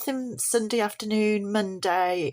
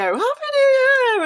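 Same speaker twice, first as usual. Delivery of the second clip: high, loud, drawn-out celebratory whoop; New Year cheer; 8 dB louder, slower than usual. Acted. Vlogger showing the greeting she missed giving, cheering loudly. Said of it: "I haven't been here to go".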